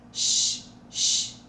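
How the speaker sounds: a hushed unvoiced sh; sh sound. Shown as a demonstration. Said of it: "que é o som do sh". (som de sh)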